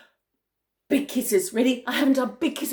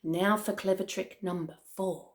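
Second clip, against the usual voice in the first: lower, softer scheming voice; sly crocodile; 5 semitones lower, 7 dB quieter than usual. A narrator's reading. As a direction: plot it under your breath. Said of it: "the enormous crocodile said".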